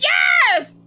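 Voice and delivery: high, loud cheering yell; hollering yes. Retold or imitated. Kendra cheering Oliver from the background, high loud whoop. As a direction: whoop it like a hype woman. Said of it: "Kendra in the background hollering".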